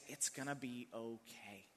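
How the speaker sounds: soft, slow comforting words; gentle reassurance. Retold or imitated. Reassuring a humiliated kid in the lobby, softly and slowly. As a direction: hush it gently to a crushed kid.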